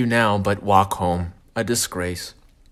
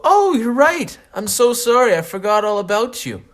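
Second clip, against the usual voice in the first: high, loud over-the-top apology; hammed-up remorse, pitched way up; gushing apology; 11 semitones higher, 6 dB louder than usual. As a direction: apologize profusely in a raised voice. Gushing apology to his penis.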